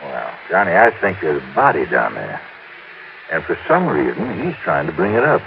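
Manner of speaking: ominous murmur; hushed suspicion. Acted. Buster confides suspicion of a body.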